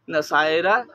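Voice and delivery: normal talking voice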